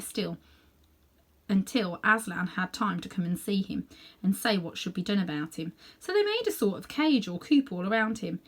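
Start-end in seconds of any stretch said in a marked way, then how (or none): none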